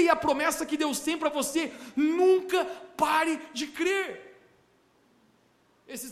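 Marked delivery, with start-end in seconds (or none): 2.0-4.1 nunca shouted high, then slow; preacher's rising shout; shouted urging. Acted. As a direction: cry out never stop believing.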